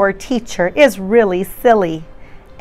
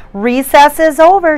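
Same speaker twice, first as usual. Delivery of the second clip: higher, louder teacher yell; 7 semitones higher, 6 dB louder than usual. Acted. Teacher yells to the children outside, higher and louder. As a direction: call the class in from recess. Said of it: "she yells".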